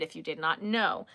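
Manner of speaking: normal talking voice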